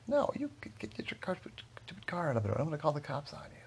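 high, stumbling rant at contained volume; mock angry yell. Driver threatening Bill over the blocked road, mock angry yell. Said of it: "started yelling at Bill".

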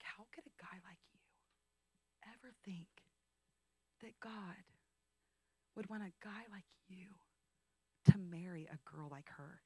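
hushed, unvoiced whisper of a cruel remark; whispered mentor's words. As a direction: breathe the insult out in a whisper. Mentor's cutting words retold.

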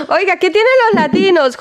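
asking through real laughter; high, giggly question between laughs; laughing. Real, not acted. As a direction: laugh while you repeat the question. Host laughing, repeats question.

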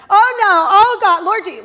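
loud, high, terrified cries; panicked scream. Retold or imitated. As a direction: shriek like the movie just got you. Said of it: "So I'm screaming".

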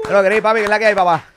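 loud, high, hyped-up hello; excited greeting. Real, not acted. Host welcoming his guest onto the show, loud and hyped. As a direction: hail your buddy like he just walked in.

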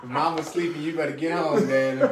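reading the son's message through laughter; laughing. Real, not acted. Relaying son's message while laughing.

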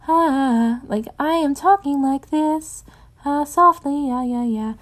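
lilting sing-song voice she gave Cass; sing-song accent. Shown as a demonstration. Author demoing her old Cass voice, sing-song. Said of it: "a sing-song accent".

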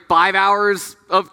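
normal talking voice

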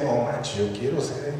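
hushed, wishful aside; quiet admiration. Retold or imitated. Preacher admiring the world's best marriage, hushed and wishful. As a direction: murmur it softly as an envious wish. Retold awed admiration of couple.

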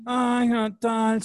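high, slow, drawn-out mimicry; imitated distracted traveler. Teasing co-host as she fiddles with her things, dreamy mimicry. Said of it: "Y tú así".

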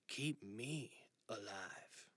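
soft, slow whisper. Acted. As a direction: breathe it out slowly. Jack's last quiet plea.

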